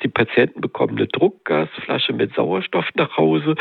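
usual speaking voice

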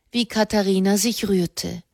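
plain reading voice

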